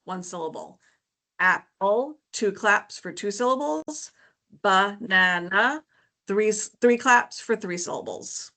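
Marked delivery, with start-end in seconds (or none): 1.4-2.2 "Apple" broken into two separate beats; split into syllables. Shown as a demonstration. Teacher showing parents clapping syllables, "Apple" in two beats. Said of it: "have them clap out the syllables". 4.6-5.8 "Banana" slowly, in three separate beats; split into syllables. Shown as a demonstration. Teacher showing a clapping game, "Banana" slowly syllable by syllable. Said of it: "have them clap out the syllables".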